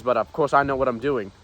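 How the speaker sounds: normal talking voice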